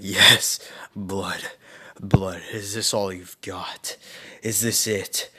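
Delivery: low, drawn-out relish, half-whispered at times; lightly acted crazed killer, slow and low; maniacal bloodlust. Naruto slaughtering bandits, mock maniacal bloodlust. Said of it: "he's laughing maniacally".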